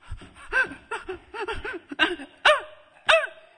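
loud high-pitched bursts of mock tantrum; high repeated tantrum cries; tantrum noises. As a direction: act out a kicking, screaming child without words. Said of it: "and screamed and kicked".

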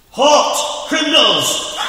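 high, loud hammed-up police bark; mock cop shout. Railroad cop catching the kids on the boxcar, hammed-up shout. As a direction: bellow it like a pompous cop.

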